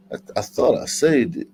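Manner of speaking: normal talking voice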